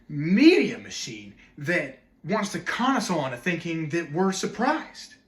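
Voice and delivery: loud, high, exasperated argument; worked-up outrage with big pitch swings; indignant rant. Acted. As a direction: rail at the interviewer, punching key words. Acted indignant rant at Missus Oates.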